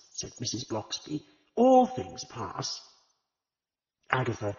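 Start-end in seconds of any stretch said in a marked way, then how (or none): 1.6-2.8 consoling. A narrator's reading. Comforting grieving Agatha.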